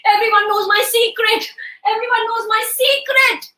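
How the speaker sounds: rising, hammed-up panic; panicking king. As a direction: wail it like a worried king. The worried king panics while pacing.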